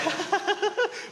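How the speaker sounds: put-on high-pitched childlike giggling; imitated excited kids. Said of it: "The kids are like".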